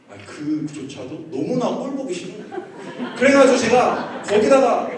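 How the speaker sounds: ordinary talk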